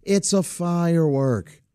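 imitated young niece. Niece setting silly uncle straight, imitated slow explaining. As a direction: spell it out slowly, as a child to a silly adult. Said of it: "And she'd go".

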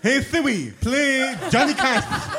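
voice assistant command. Acted. Mock voice command to phone.

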